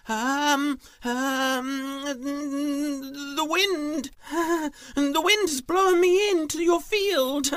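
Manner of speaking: very high, loud nervous squeak; stammering thief. Acted. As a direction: stall and stammer in a tiny high voice. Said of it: "She stuttered and she stammered".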